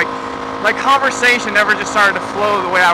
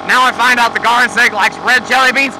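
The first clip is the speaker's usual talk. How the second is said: higher, louder hammed-up outrage, shouting in mock disgust; mocking an outraged person; 4 semitones higher, 4 dB louder, slower than usual. Vlogger mocks oversensitive viewers over jelly beans, hammed-up shouting outrage. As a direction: ham it up like someone betrayed over jelly beans.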